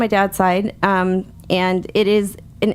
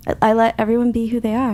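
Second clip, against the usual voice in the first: usual speaking voice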